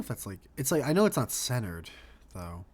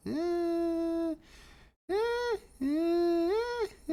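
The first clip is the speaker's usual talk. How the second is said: high squeaky eh; much slower than usual. Real, not acted. Fussing over centering.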